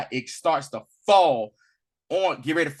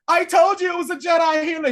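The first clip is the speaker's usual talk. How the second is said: high, louder shouted claim; mock shout; 11 semitones higher, 6 dB louder than usual. Brother shouting at the Inquisitor, high mock yell. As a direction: yell it like a desperate snitch.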